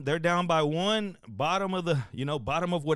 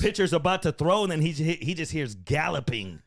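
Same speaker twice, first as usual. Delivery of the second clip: usual speaking voice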